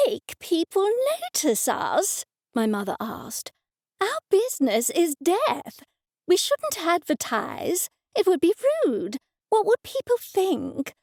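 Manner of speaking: high, shocked, indignant mother; scandalised mother. A narrator's reading. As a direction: recoil at the very idea.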